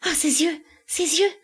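high-pitched horrified exclamation; half-stifled cry. A narrator's reading. Elida recoils from the stranger's eyes, half-stifled cry. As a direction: cry out, held in, pitch leaping. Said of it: "pousse un cri à demi étouffé".

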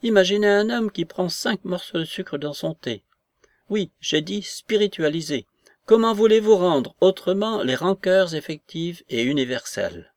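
high, agitated grande dame; exasperated lady demanding to be understood; indignant outburst. A narrator's reading. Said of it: "avec une nouvelle explosion".

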